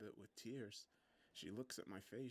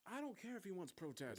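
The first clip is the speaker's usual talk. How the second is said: doctor's irritated, higher-pitched retort; snapping back; 9 semitones higher than usual. A narrator's reading. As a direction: snap at the nurse, pitch raised then falling. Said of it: "the doctor snaps back".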